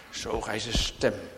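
ordinary delivery

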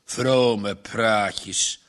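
low growled dismissal; growled scoff. Acted. Farmer dismisses Margje's pious words with a growl. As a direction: scoff it under your breath. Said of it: "gromde de boer".

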